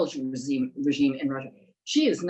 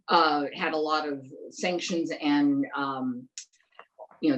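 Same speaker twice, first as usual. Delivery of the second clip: ordinary talk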